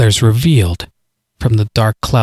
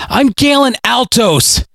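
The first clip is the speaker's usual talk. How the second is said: name cried out high and slow; shouted; 11 semitones higher than usual. Acted. Galen yells his name at the voice in his head. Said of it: "he finally shouted".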